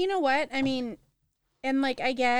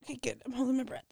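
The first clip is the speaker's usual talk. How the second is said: hushed whisper; 8 dB quieter, faster than usual. Real, not acted. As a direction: breathe it out softly. Whispered while holding breath.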